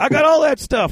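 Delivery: high, quick insistence; retold eager boast. Friend telling host he has supplies, mock eager.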